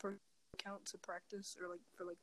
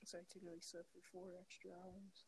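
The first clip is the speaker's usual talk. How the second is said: quiet at night; 8 dB quieter than usual. Real, not acted. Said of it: "it sounds like you're whispering".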